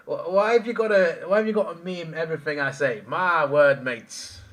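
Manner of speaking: high, loud exasperation; exasperated protest. Real, not acted. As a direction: complain loudly at being teased. Protests at being mocked by chat.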